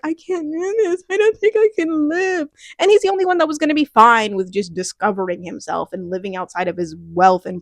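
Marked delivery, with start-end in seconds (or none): 0.0-2.5 high-pitched mock despair; imitating a distressed character. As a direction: voice her helplessness up high. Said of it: "she, like, is the one who's like".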